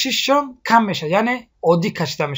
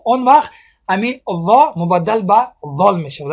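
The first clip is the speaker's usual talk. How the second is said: ordinary talk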